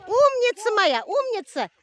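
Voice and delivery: high, loud and drawn-out praise; squeaky praising. Real, not acted. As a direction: gush over her at the finish. Mother praising daughter at finish.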